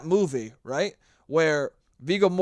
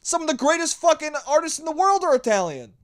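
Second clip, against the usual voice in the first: indignant disbelief; 8 semitones higher, 3 dB louder than usual. Retold or imitated. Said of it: "And I'm like".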